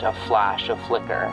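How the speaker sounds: ordinary delivery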